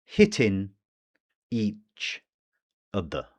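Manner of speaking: "Hitting" "each" "other" said as three separate words with gaps; "Hitting each other" unlinked, a pause after each word; words separated. Shown as a demonstration. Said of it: "there's the catenation".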